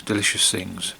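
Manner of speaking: ordinary talk, nothing marked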